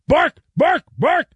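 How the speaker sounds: high, sharp mock barking; imitated dog bark. Player barks at the door, imitated barking. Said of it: "just like that".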